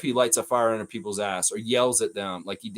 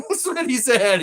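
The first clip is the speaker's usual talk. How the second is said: higher and louder, through a laugh; real laugh at the player's blunt line; 10 semitones higher, 5 dB louder than usual. Real, not acted. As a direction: laugh out the punchline. Laughs after quoting the warning.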